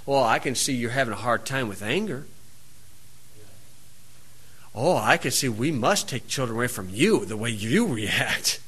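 low, soft, smug calm voice; calmly says. Retold or imitated. Official to angry parent, calm and soft. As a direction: imitate the official's cool, soft put-down. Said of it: "the person just calmly says".

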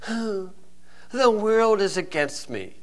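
slow, soft, high whimpering complaint; mock a sulking brat, drawn out; whiny voice. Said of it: "his whiny little voice".

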